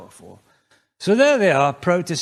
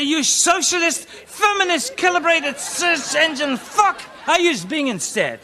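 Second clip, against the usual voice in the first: angry rant; 13 semitones higher, 6 dB louder than usual. Retold or imitated. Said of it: "I say".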